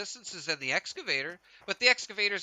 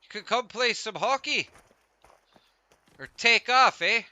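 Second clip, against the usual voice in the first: hammed-up Canadian accent, with a louder push on "take off, eh"; 6 semitones higher, 6 dB louder than usual. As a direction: tease in a jokey Canadian accent. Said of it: "Is that better?".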